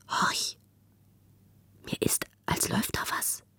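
breath-only, very soft whisper. A narrator's reading. Gritta to Müffert, hearing something move, whispering. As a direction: whisper as if listening for a sound. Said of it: "ein leises Geflüster".

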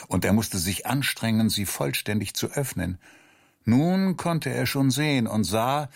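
ordinary delivery, nothing marked

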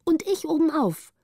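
put-on high, eager child calling out. A narrator's reading. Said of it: "rief Petrina". (eifrig und hoch gerufen)

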